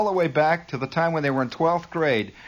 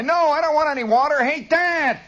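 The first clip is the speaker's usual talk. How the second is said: put-on high, insistent kid's holler; 11 semitones higher, 3 dB louder than usual. Shown as a demonstration. Kid refusing water from the stairs, hollering. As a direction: insist loudly from upstairs. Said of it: "go to the head of the stairs and holler".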